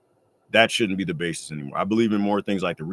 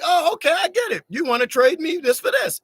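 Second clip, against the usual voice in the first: mock sudden understanding; 4 dB louder than usual. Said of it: "And I'm like".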